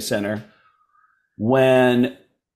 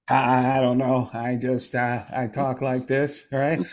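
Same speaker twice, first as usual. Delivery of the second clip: soft, halting celebrity impression; Adam Sandler; 4 dB quieter than usual. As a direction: mumble it haltingly, in character. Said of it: "he does an amazing impression of you".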